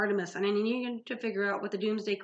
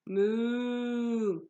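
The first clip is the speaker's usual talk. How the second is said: cow moo; 2 semitones higher than usual. A narrator's reading. Cow serpent moos.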